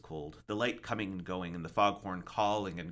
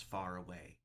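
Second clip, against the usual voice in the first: ordinary delivery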